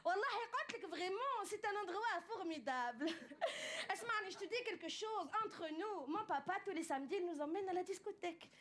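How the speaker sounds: lightly sing-song Tunisian accent. Shown as a demonstration. A comedian demonstrates how Tunisians talk, lilting Tunisian accent. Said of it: "les Tunisiens, quand on parle, on a tendance à chanter".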